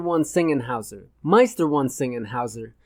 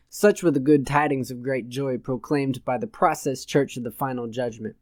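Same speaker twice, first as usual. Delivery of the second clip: ordinary delivery, nothing marked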